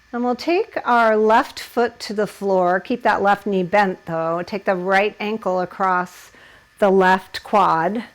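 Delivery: ordinary delivery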